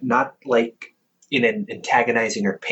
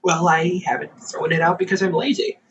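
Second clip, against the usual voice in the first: normal talking voice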